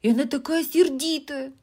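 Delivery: put-on high, loud, anxious girl voice; worried little girl pleading; a bit frightened. A narrator's reading. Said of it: "сказала Эми довольно испуганно".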